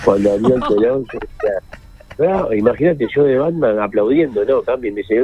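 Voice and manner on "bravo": high, retold shout of bravo; mock cheering. Audience member cheering his colleague on stage, mock cheering.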